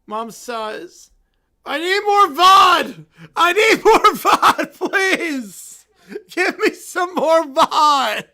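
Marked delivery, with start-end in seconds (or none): 1.7-8.2 put-on loud, laughing drunk shouts for more; drunken shouting demand. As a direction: bellow it like a tipsy princess. Princess demands more vod.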